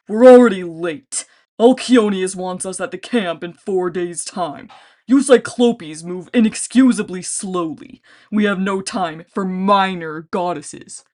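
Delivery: loud, slow booming scold; impatient giant. A narrator's reading. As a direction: chide the slow troops. Giant scolds his troops.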